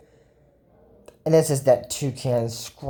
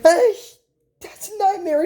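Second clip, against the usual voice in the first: alarmed cry; 4 dB louder than usual. Acted. Dream turns bad, alarmed.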